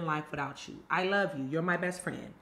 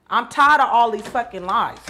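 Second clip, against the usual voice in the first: furious shout; 10 dB louder than usual. Acted. Furious at lying friend.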